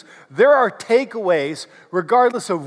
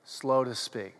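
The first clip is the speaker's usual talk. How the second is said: much softer and lower; slow and quiet; 8 semitones lower, 11 dB quieter, slower than usual. Shown as a demonstration. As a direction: drop the voice down low. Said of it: "say it with me and do the hand motions".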